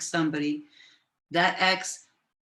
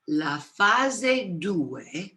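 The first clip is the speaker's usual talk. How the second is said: slow, each word of "la fase due" drawn out; much slower than usual. Shown as a demonstration. Teacher slowing the phrase for livestream students, slow. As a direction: spell out "la fase due" slowly for note-takers. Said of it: "So I'll slow it down again".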